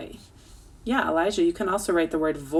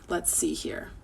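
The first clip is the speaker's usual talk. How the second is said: whisper; 8 dB quieter than usual. Real, not acted. Teacher thinks aloud.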